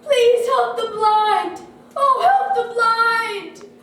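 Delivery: loud, high, drawn-out plea; crying out. A narrator's reading. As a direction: call out like a begging blind man. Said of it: "poor Bartimaeus cried".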